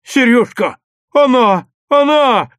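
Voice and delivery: high, loud cries to a friend; thrilled boyish yelling; excited calling out. A narrator's reading. Boy excitedly calls his friend.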